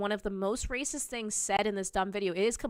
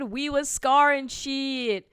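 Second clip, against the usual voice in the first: slow, loud, mocking voice of the fan; mock quote; 3 semitones higher, 8 dB louder, much slower than usual. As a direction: drag it out in a mocking put-on voice. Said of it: "As D J said".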